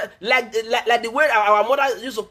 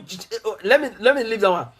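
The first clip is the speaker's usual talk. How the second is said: usual speaking voice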